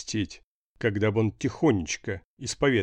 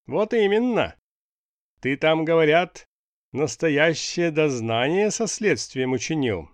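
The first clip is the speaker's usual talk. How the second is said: boss voice; 6 semitones higher, 4 dB louder than usual. A narrator's reading.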